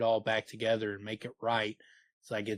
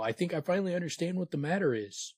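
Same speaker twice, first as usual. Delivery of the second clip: higher, fast, near-breaking hysteria; high and hysterical; 8 semitones higher, faster than usual. Acted. Bass realizing the truth to Kvothe, high hysterical rush. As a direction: let it spill out fast and high. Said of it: "his voice high and hysterical".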